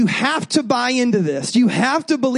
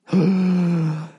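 mock gasp; 4 semitones lower than usual.

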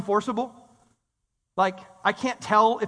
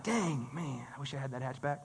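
low, soft, dragged-out longing; mock envious murmur; 9 semitones lower, 13 dB quieter than usual. Preacher joking about coveting a hatchback, soft mock envy. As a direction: murmur it like a joke wish.

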